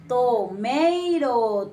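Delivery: "Tomato" stretched out syllable by syllable; very slow, emphatic "Tomato"; slow model word. Shown as a demonstration. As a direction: draw "Tomato" out long and clear for learners. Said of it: "Tomate en inglés se dice tomato".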